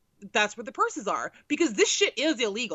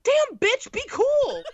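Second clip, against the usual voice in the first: exasperated; 14 semitones higher, 4 dB louder, slower than usual. Retold or imitated. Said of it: "I'm like".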